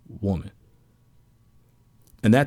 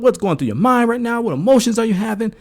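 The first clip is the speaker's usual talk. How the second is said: high, eager mock reporter voice; mock interviewer; 9 semitones higher than usual. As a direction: play a pageant host fishing for feelings. Mimicking a pageant host's questions.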